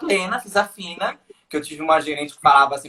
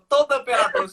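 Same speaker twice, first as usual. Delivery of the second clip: ordinary talk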